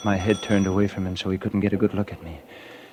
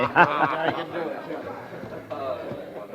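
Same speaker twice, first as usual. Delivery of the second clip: a long laugh that falls away; amused laugh after a joke at cards; hearty laugh; 6 semitones higher, 6 dB quieter than usual. Acted. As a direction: let the laugh roll out and fade. Laughing after card banter.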